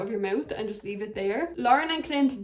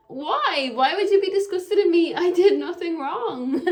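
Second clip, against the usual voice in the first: higher, louder whiny protest; mock Jackie; 6 semitones higher, 5 dB louder than usual. Vlogger imitating Jackie denying wrongdoing. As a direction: play the wronged innocent. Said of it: "Jackie is like".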